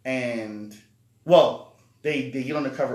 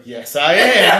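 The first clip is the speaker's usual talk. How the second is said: imitating girl; 5 semitones higher, 14 dB louder, slower than usual. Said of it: "she was like".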